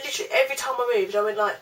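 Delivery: normal talking voice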